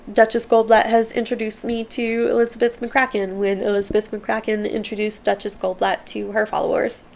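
ordinary talk, nothing marked

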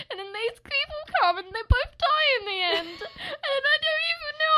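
high, tearful-sounding wail through laughter; super emotional. Real, not acted. Fan retelling a tragic music video, super emotional. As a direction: get overwhelmed, voice climbing very high. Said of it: "You're getting super emotional".

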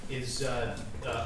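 ordinary delivery

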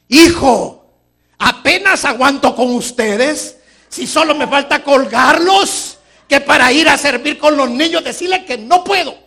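loud, high, slow exasperated rant; fed up, snap back at the kid; mother interrupted while praying, fed up. Acted.

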